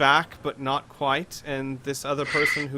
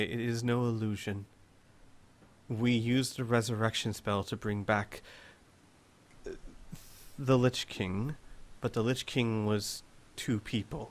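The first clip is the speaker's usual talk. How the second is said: low, soft, measured lady; quiet, composed explanation in character; composed Lady Selene; 3 semitones lower, 7 dB quieter than usual. Acted. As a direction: explain it gently, voice dropped.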